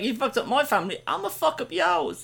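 a hint of a menacing villain, slowed down; imitating the villain. Podcaster imitates the film villain's threat, lightly voiced. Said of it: "In that voice".

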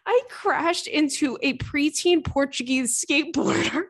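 high-pitched, barely held-in laughter; laughing confession. Real, not acted. Host confessing an embarrassing bike crash, laughing through it. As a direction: confess it while fighting back laughter.

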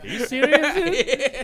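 high laughing disbelief. Real, not acted. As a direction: laugh it out in surprise. Laughing at friend.

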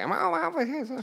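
offended person. Retold or imitated. Offended prank victim, mock flustered. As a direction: play someone shocked and sputtering. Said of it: "It's just like".

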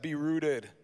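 light Minnesota accent; a hint of Minnesota. Shown as a demonstration. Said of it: "Give me your best, uh, Minnesota accent and say that".

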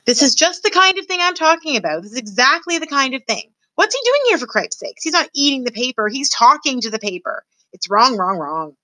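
a put-upon mouse fretting at his brother; fussy mouse brother Furlough. Acted.

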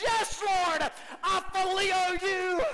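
high, loud shout; exclaimed. Acted. Peter riled up, shouting back at Jesus. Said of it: "with exclamations".

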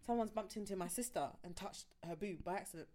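ordinary talk, nothing marked

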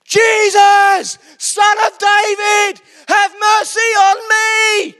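very loud, high, slow drawn-out yell. Retold or imitated. Blind beggar yelling past the shushing crowd to Jesus, full shout. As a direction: bellow it out over everyone. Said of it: "He shouted all the more".

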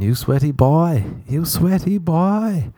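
high-pitched, drawn-out teasing chant; hammed-up high female voice; imitating the woman. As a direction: mimic her in a slow, high sing-song. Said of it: "And she kept saying".

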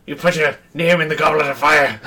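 Dumbledore line in a put-on Irish accent. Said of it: "that's an Irish Dumbledore".

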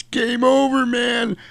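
Hudson freaking out. Retold or imitated. Hudson panicking to the squad after the crash, high and frantic. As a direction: squeal it like everything is lost. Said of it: "he does the famous".

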